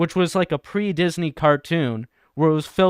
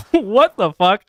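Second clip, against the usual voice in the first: higher, louder mock disbelief; retold shock; 6 semitones higher, 5 dB louder, slower than usual. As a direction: blurt it out as a startled reaction. Retelling own shocked reaction.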